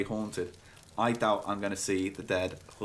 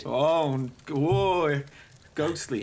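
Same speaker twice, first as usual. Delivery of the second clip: higher, hammed-up ghostly wail; mock spooked; 5 semitones higher, 3 dB louder, much slower than usual. Playing along with the ghost story, mock spooked. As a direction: play along with exaggerated spooked awe.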